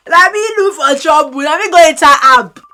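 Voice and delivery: usual speaking voice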